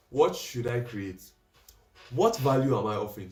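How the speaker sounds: slow, deliberate read with pauses; slow measured tone. Shown as a demonstration. Coach demoing a slow tone for camera. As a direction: take it slow and thoughtful. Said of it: "what tone do you want to say it in".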